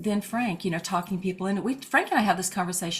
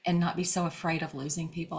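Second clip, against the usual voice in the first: ordinary delivery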